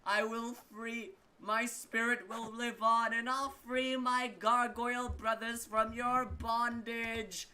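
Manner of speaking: a shattered monster's grand last words; defiant dying gargoyle. Acted. Gargoyle curses the blood witches as it falls to its doom, high, slow and loud.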